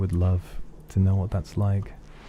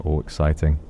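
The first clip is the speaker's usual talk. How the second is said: ordinary delivery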